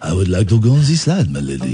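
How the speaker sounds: low, suave French Roger Moore; comic French accent, dropping deep at the end. Shown as a demonstration. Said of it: "That's French Roger Moore".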